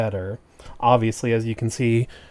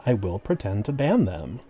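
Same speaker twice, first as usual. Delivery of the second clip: ordinary talk, nothing marked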